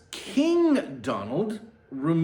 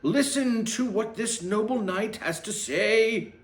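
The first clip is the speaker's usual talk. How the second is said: herald proclamation; 7 semitones higher than usual. Acted. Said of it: "he proclaimed".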